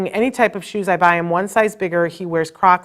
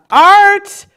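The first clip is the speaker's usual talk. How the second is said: delighted, much higher and louder shout of a name; excited call; 13 semitones higher, 11 dB louder than usual. Real, not acted. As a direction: call out to a friend joining the live. Greeting a guest who pops in.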